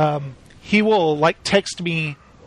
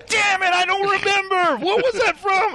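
much higher mock exasperation; frustrated friend; 12 semitones higher than usual. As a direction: squeal out the forgetting. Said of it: "And he's like".

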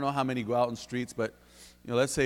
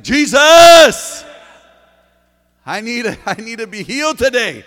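far higher, louder pleading cry; desperate yell; 10 semitones higher, 21 dB louder than usual. Retold or imitated. Blind man crying out to Jesus for healing, desperate yell. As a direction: beg him loudly to be healed. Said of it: "He's like".

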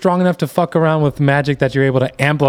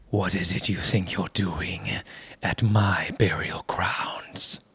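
low, slow, whispery and echoing; echoey dream voice; 5 semitones lower, 10 dB quieter, slower than usual. Acted. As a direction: speak like a voice in a dream. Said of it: "in a very echoey, you know, your typical dream voice".

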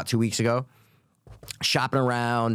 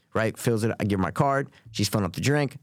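normal talking voice

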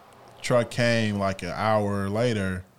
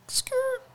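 squeak imitation. Imitating a squeaking car sound.

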